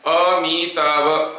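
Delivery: Amitabh drawn out slowly in four separate sounds; slow demonstration of Amitabh, sound by sound; syllable by syllable. Shown as a demonstration. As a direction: stretch each syllable of Amitabh. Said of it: "I am creating four different sounds".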